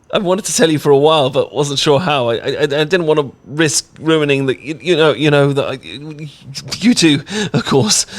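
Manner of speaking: nervous happy babbling, fast; excited rambling. Acted. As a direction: gush it out all at once. Excited rambling after confession.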